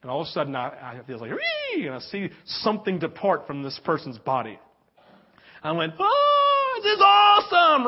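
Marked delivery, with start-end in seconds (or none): 1.3-1.9 high squealed "wee" noise; high-pitched whee. Retold or imitated. Preacher mimicking what he felt in a dream, high squeal. Imitates spirit leaving the dream person. 6.0-7.8 high, loud, whooping exclamation; extreme excitement. Retold or imitated. Preacher reliving a dream miracle, ecstatic shout. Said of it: "I just had this extreme excitement".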